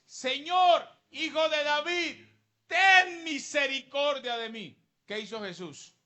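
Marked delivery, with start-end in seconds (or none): 0.1-4.8 high, loud, slow wail for mercy; a mother begging Jesus to heal her daughter, wailing; desperate cry. Acted. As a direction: cry out to him with everything you have. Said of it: "ella clamó".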